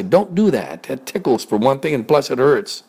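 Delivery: high whiny protest; complaining pig. Acted. Pig scolds the biting bird, whining. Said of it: "He'd say".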